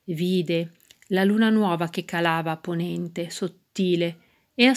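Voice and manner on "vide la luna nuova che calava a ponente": ordinary delivery, nothing marked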